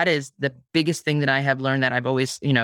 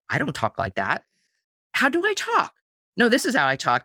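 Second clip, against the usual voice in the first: puzzled self-talk; 6 semitones higher than usual. Retold or imitated. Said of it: "hear yourself go".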